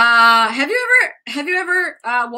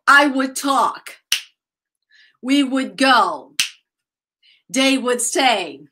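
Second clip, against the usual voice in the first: slow, evenly spaced teacher examples; slow, careful demonstration with falling endings; model sentences for repetition; slower than usual. Shown as a demonstration. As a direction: say each short sentence slowly for learners. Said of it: "Guys, repeat".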